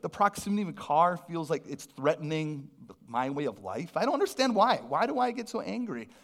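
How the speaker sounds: ordinary talk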